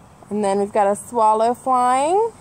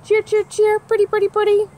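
much higher, bright whistled cheer; cardinal song; 11 semitones higher, 5 dB louder than usual. Acted. Reading to kids, a high cardinal song. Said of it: "Cardinal wears a pointy hat".

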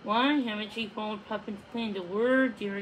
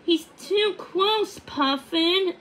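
higher, louder worried cry; Odette crying out in alarm; 8 semitones higher, 5 dB louder, slower than usual. A narrator's reading. Odette warning Puffin the hunter is near, alarmed. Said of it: "Odette cried".

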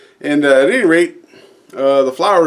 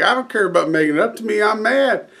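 fast and higher, annoyed; mild anger; 6 semitones higher than usual. Retold or imitated. Customer snapping at the florist, fast and higher. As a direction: rattle it off, a touch of a huff.

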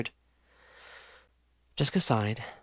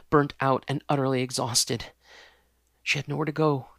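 usual speaking voice

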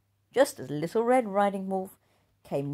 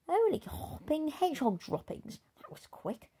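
higher then dropping away, startled; startled bully girl; 10 semitones higher, 4 dB quieter than usual. Acted. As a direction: caught off guard by his arrival.

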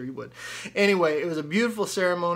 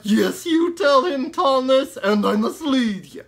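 high, loud, slow puppet cheer; a gleeful squeaky pig egging him on; squeaky ventriloquist dummy; 7 semitones higher, 9 dB louder than usual. Acted.

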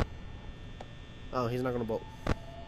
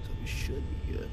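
whispering; 5 dB quieter than usual. Real, not acted. Hushed calming.

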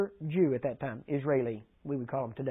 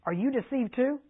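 much higher, mocking; scornful question; 7 semitones higher than usual. Pharisees mock the officers.

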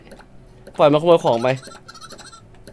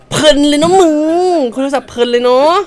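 1 sarcastic scolding; 5 semitones higher, 4 dB louder than usual. Acted. Scolds the customer on the phone.

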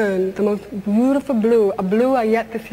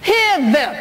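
defiant no; 5 semitones higher, slower than usual. Retold or imitated. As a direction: slam the door on fate. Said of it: "learned how to say".

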